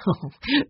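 short amused chuckle; soft chuckle. A narrator's reading. Grandfather amused by Benny's mistake, chuckling. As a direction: chuckle briefly before explaining. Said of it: "His grandfather chuckled".

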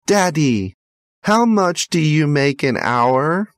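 put-on high child's voice, innocent question; curious little boy. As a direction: ask like a small boy who wants to know. Boy asks father's wage.

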